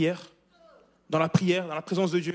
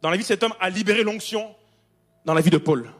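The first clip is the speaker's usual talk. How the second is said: full-force preaching; 6 dB louder than usual. Acted. Preacher to congregation about Paul, loud fervent preaching. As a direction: proclaim it to the back row.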